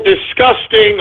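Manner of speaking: ordinary talk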